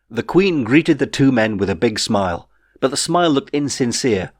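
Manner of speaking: plain reading voice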